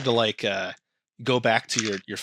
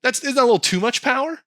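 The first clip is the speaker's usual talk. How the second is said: high, fast near-shout of disbelief; a shocked squeal at the overkill; incredulous; 13 semitones higher, 5 dB louder, faster than usual. Retold or imitated. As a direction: blurt it out in loud disbelief. Incredulous reaction to weapon.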